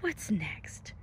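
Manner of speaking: ordinary delivery, nothing marked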